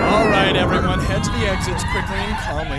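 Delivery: high, booming call to evacuate; loud order. Acted. Professor calling everyone to the exits, loud. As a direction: call the room out over the fire.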